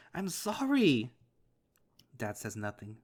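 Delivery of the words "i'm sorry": high, slow, stretched sorry; wailing, slowed apology; drawn-out sobbing apology. Acted. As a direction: let the sorry break and drag. Apologizing to dad, desperate.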